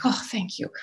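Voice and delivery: soft, breathless relieved thank-you; hushed thanks. Retold or imitated. Mom quietly thanking the nurse on the helicopter, hushed. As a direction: let the relief out under your breath.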